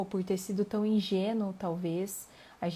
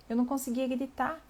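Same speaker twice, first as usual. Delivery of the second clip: ordinary talk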